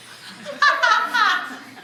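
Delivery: a high cackle that falls away; gleeful laugh. Acted. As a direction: cackle at your own punchline. Laughs at her own joke.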